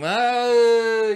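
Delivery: a sudden high, loud oh; a loud, high exasperated cry. Real, not acted. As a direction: blurt it out in disbelief. Outburst of indignation.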